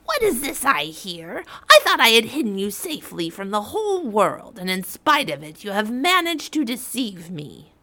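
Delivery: put-on furious witch scolding the girl; angry witch. A narrator's reading. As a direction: scold her like a betrayed old witch. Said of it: "cried the witch".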